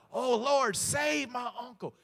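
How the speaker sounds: high, loud desperate plea, slowing on save my uncle; retold cry out. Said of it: "he cried out".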